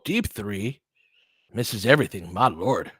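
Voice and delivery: normal talking voice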